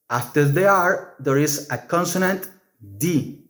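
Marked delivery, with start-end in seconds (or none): none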